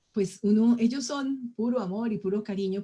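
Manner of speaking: ordinary delivery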